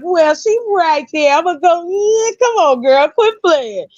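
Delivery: high, coaxing pushy dude; pushy guy voice. Retold or imitated. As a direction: lean on her like a pushy guy. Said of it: "'cause you was like".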